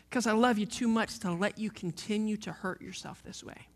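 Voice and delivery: ordinary delivery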